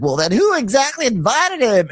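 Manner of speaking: high, pointed host voice; a campy gotcha question; mocking Andy. Host Andy pressing Drew, high campy voice. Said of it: "He's like".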